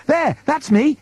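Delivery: bursts out high with excitement; excited. Acted. Eddie spotting his own jar, excited high cry. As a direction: blurt it out, thrilled to recognise himself. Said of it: "he's excited by this first encounter".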